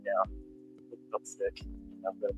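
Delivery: ordinary talk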